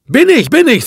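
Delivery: quick-rising, high-pitched assurance; eager confirmation. A narrator's reading. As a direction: narrator voicing a keen yes, pitched well up. Man eagerly confirms he is German.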